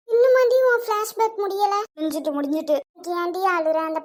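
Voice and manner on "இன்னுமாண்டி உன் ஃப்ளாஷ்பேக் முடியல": louder, higher mock-annoyed jab; teasing exasperation. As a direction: tease her for daydreaming. Friend interrupts daydream, teasing. (இன்னும் முடியலையா என்ற கிண்டலான கேள்வி)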